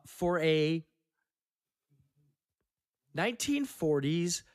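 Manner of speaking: usual speaking voice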